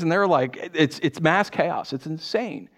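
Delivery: ordinary talk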